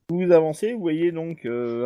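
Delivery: usual speaking voice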